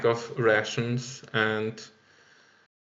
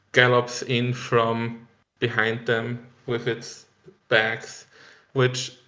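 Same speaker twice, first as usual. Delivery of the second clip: ordinary delivery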